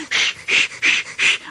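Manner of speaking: whispered shh puffing rhythm; soft unvoiced chuffing; engine puffs. A narrator's reading. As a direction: puff like a steam engine.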